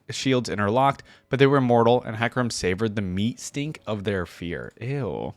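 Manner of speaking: ordinary talk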